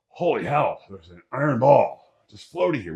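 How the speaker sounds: loud, high mock astonishment; hammed-up wonder at a floating ball; amazed. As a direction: gasp at it like you can't believe your eyes. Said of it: "You're like".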